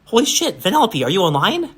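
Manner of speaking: high, swooping cartoon character voice; cartoony voice. A narrator's reading. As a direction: pipe up like an excitable cartoon kid. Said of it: "A cartoony, familiar voice occurs".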